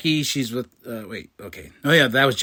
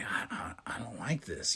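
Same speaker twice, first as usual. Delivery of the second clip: very soft, low, hesitant stammer; uneasy murmur; 6 semitones lower, 16 dB quieter than usual. Retold or imitated. Jimmy doubting his family's plan, uneasy murmur. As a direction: stumble into it under your breath.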